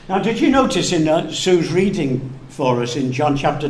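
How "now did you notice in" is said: normal talking voice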